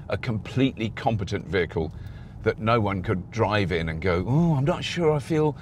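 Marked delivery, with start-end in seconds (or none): 4.3-5.6 higher, whining doubter; whiny voice. Retold or imitated. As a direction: whine it like a nervous buyer. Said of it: "I have to put on a whiny voice".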